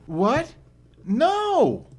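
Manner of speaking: high, startled woman's voice; a woman suddenly alarmed; lightly voiced alarmed woman. Woman protests being dropped off.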